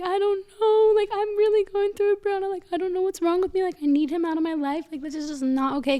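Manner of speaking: high, breaking voice, on the edge of crying; upset, near tears. Retold or imitated. Telling her best friend she is falling apart, high and tearful.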